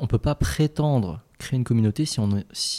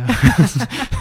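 nervous laugh; 6 semitones higher, 6 dB louder than usual. Real, not acted. Joke about hacking their Drive.

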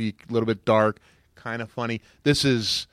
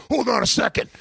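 imitating Hulk Hogan; 8 semitones higher, faster than usual. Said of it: "he goes".

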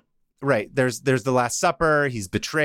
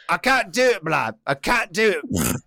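higher, comic British wail; muddled British accent; 4 semitones higher than usual. Acted. Twin brother has had enough, higher mock British. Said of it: "I don't know where this accent comes from".

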